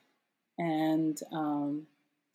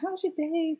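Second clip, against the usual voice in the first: much higher voice for quoting someone; high-pitched quoted question; 11 semitones higher than usual. Retold or imitated. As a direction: imitate a cousin asking, pitched way up. Cousin's question in retold chat.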